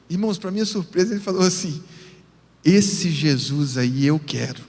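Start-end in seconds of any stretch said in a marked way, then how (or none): 2.6-4.6 father's answer. Retold or imitated. Father accepts Jesus.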